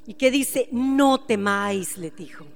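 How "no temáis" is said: slow, low and soft; calm frightened followers slowly and quietly; soothing reassurance. Acted. Jesus calming frightened disciples.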